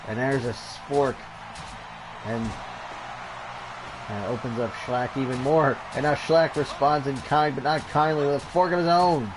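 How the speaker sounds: lively ringside wrestling call; energetic play-by-play over a brawl; play-by-play commentary. Acted. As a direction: call the match like a TV announcer. Live wrestling game play-by-play.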